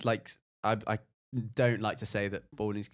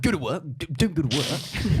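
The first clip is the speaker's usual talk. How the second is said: loud, higher, stumbling posh voice; Boris Johnson impression; 6 semitones higher, 9 dB louder than usual. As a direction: ham up a posh politician, slowing down. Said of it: "That was a brilliant Boris Johnson impression".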